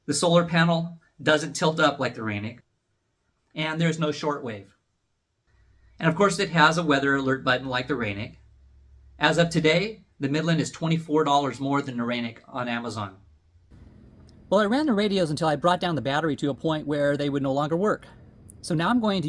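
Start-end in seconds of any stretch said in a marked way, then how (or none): none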